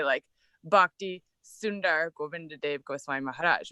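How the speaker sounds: Bhakti Sundar Govinda Dev Goswami Maharaj said carefully in Sanskrit style; Sanskrit pronunciation. Shown as a demonstration. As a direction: lay out each name syllable by syllable, the Sanskrit way. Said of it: "That's how you say it".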